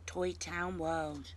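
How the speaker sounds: ordinary delivery, nothing marked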